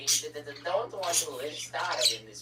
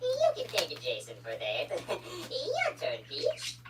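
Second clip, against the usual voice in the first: squeaky voice; 7 semitones higher than usual. Acted. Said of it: "you two sound even squeakier than usual".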